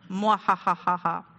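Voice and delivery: mad scientist cackle; villainous mwahaha; evil laugh. Pretend. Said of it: "I was either gonna do an evil laugh".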